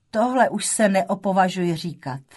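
usual speaking voice

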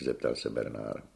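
ordinary delivery

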